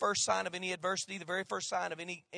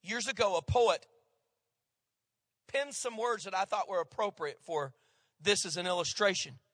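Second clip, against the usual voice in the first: ordinary talk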